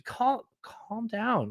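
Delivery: slow, soft and high; soothing calm down. Retold or imitated. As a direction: talk him down gently and slowly. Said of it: "You're just like".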